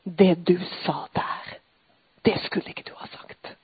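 unvoiced, hushed accusing whisper; Satan whispering. Retelling how Satan comes, in a whisper. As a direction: breathe it like a sly tempter at her ear. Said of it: "Vet du hvordan Satan kommer?".

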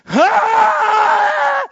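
very high, loud shrieking scream; piercing scream. Shown as a demonstration. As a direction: let out a full scream. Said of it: "Skrik litt høyere du også". (gjennomtrengende skrik)

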